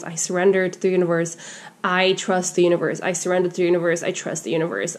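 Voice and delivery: low, soft repeated mantra; reciting affirmations. Shown as a demonstration. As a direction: murmur affirmations to yourself, low and even. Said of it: "you start doing the affirmations".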